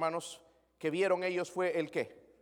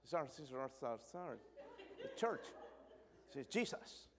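soft, lower, halting mock English; broken English fumbling; 3 semitones lower, 13 dB quieter than usual. Church member fumbling English with an American, soft and halting. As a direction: laugh through clumsy English apologies.

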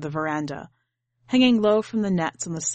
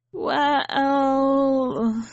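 stammering; 7 semitones higher, 3 dB louder than usual. A narrator's reading. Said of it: "you stammer".

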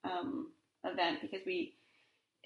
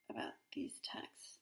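whispered aside; 11 dB quieter than usual. Real, not acted. Apologizing for texts, whispered aside.

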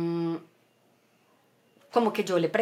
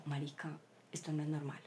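much softer, lower anxious murmur; 5 semitones lower, 15 dB quieter than usual. Retold or imitated. Frightened mother realizing husband isn't okay, soft anxious murmur. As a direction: say it low, worried something is wrong. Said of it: "yo ahí sí me angustié".

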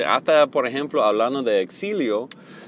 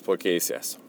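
sorrowful sigh; 3 semitones lower than usual. Shown as a demonstration. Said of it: "un sentido de pena, como".

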